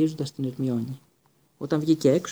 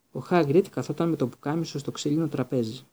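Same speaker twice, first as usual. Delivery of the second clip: plain reading voice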